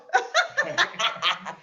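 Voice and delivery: helpless high giggle; real laughter. Real, not acted. Teased by Alan in service, lets out high giggling laugh. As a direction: laugh it off, caught out by a friend.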